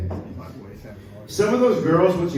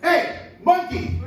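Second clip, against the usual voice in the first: higher, slow drawn call; gator calls up; 12 semitones higher, much slower than usual. Acted. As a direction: call up the tree slowly.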